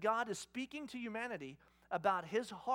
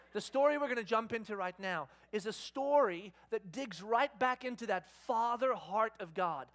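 normal talking voice

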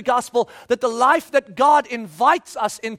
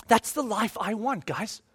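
softer, said to himself; soft aside; 3 semitones lower, 7 dB quieter than usual. Real, not acted. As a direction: murmur it quietly. Repeated line, first take.

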